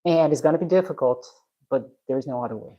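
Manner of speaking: ordinary talk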